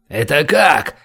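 taken-aback, incredulous question; goggling surprise. A narrator's reading. Boris questions the hostage's request, astonished. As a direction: blurt it out, baffled. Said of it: "вытаращился Борис".

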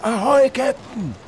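high, slow hail across the deck; boatswain calling. Acted. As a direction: call out to the captain over the wind. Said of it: "rief der Bootsmann".

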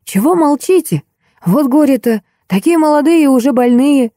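put-on high lamenting old man; fretting old man. A narrator's reading. Old man laments that the young guests are deaf, fretting. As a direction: fuss over them like a worried old man. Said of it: "забеспокоился дедуля".

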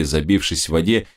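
usual speaking voice

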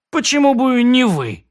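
most polite tone. Acted. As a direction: ask with exaggerated courtesy. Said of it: "спросил я самым разлюбезным тоном".

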